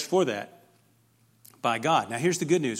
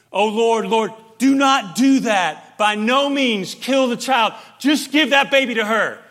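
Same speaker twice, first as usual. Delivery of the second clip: louder, higher desperate plea, slowed; mother crying out; 8 semitones higher, 12 dB louder than usual. Retold or imitated. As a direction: beg the king to spare the child. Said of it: "the true mother cries out".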